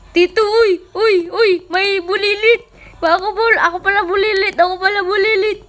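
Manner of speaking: high, loud startled yelps; excited shouting. Acted. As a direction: jump out of your skin, then laugh at yourself. Startled spotting the kid in game.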